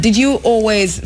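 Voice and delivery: normal talking voice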